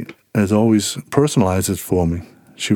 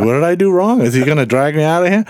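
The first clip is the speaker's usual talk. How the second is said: nervous guy; 7 semitones higher, faster than usual. Retold or imitated. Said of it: "The guy's like".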